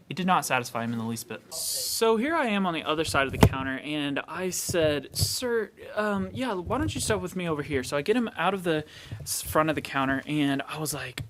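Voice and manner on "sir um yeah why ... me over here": higher-pitched, tentative appeal; placating. Retold or imitated. Clerk asking an angry customer to step aside, placating. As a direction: gently coax an upset customer aside.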